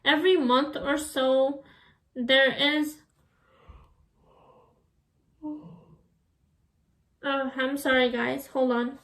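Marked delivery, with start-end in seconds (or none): 5.4-5.6 mid-yawn sound. Real, not acted. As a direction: say it through a yawn. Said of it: "sorry if I'm yawning".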